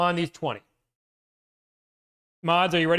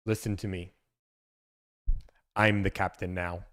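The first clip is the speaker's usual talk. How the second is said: low, quiet threatening captain; lower pirate captain; 9 semitones lower, 4 dB quieter than usual. Retold or imitated. Reading quote to chat, low menacing captain. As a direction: take over the ship, low and quiet.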